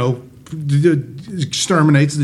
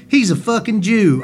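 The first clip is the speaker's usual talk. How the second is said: imitating coworker Loretta; 6 semitones higher than usual. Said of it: "she goes".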